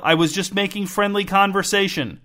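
high, protesting self-defense. A narrator's reading. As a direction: voice climbing in protest. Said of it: "edging toward the high end of the scale".

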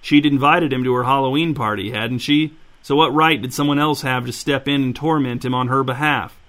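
ordinary delivery

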